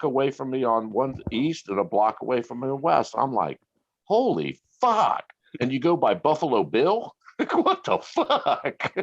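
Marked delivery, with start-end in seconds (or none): 4.0-5.2 higher and slow, drawn-out holy fuck; slowed, astonished holy fuck; slow stunned curse. Retold or imitated. Photo a block away. 7.4-8.9 high, slow, laughing what the fuck; giggling incredulous what the fuck; laughing disbelief. Real, not acted. Laughing at the absurdity.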